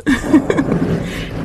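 real amused giggle; short burst of giggling. Real, not acted. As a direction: laugh lightly, a little giggly. Giggles at using own voice.

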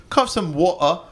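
a Brit at a diner asking Can I have some water; British accent. Retold or imitated. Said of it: "and someone goes".

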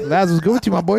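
hype greeting. Pretend. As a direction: shout it at your boy. Hyping up winner.

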